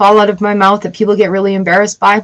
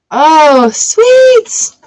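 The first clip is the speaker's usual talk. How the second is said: very high, louder delighted squeal; 7 semitones higher, 4 dB louder than usual. Real, not acted. Host reacting to her tarot cards, delighted squeal. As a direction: cheer as if thrilled.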